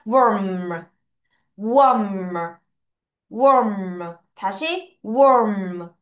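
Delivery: Warm drawn out, falling onto a hummed m; falling, full ending. Shown as a demonstration. Teacher leads choral drill, Warm slowly falling to a full m. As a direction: let Warm drop and finish the final m. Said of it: "끝을 내려서 읽고 그 마지막 철자까지".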